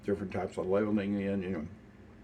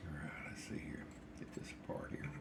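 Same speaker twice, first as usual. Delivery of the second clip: whispered to himself; 4 semitones lower, 16 dB quieter than usual. Real, not acted. Old man whispering to himself while pulling a biscuit apart. Quietly handling food.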